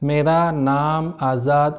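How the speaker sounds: slow, drawn-out name; too slow. Shown as a demonstration. Teacher demonstrating speaking too slowly. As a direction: stretch each word out slowly. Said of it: "आपका speed बहुत कम है".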